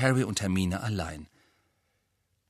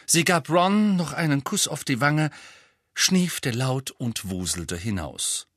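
ordinary delivery

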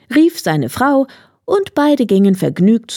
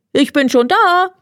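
hedgehog calling; 10 semitones higher than usual. A narrator's reading. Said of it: "rief ihm der Igel entgegen".

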